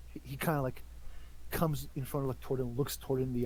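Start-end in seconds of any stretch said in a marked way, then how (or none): none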